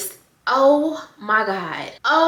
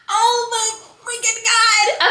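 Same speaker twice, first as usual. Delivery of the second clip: drawn out in disbelief; real elated shock, stretched slow; overjoyed disbelief; stunned, slow and high; 11 semitones higher, 3 dB louder, much slower than usual. Real, not acted. Shocked by good news.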